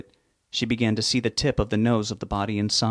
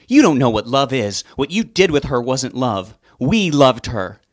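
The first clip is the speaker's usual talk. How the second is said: louder, bitter, slowing at the end; angry retort; 3 semitones higher, 6 dB louder than usual. Acted. Mother snaps back at Sue, angry and loud. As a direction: spit it back at her.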